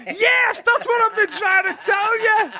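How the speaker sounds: high, loud, laughing relieved yell; mock shouted answer. Acted answer in the joke.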